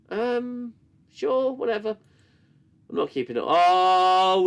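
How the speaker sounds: normal talking voice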